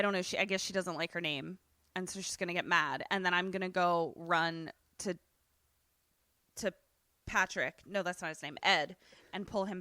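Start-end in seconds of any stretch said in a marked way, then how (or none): none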